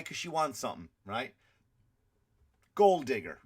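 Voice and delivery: ordinary talk, nothing marked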